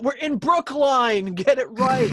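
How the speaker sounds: high, loud, slow mock local protest; mock indignant shout. Locals correct the band, hammed-up loud protest. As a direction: insist on it like an offended townie. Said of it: "Yeah, we're not".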